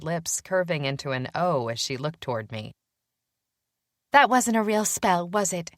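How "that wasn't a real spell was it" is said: higher-pitched, embarrassed question; mildly mortified; cringing. A narrator's reading. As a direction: ask it with a cringe.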